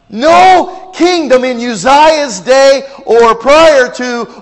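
loud, high, slow proclamation; full-force preaching. Acted. Preacher thundering to the congregation, full force.

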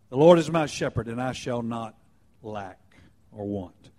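a reflective fade from a strong start; stress on Lord, then softly lack or want. Shown as a demonstration. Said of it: "emphasizing a different word each time".

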